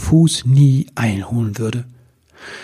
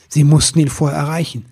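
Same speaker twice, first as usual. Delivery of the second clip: plain reading voice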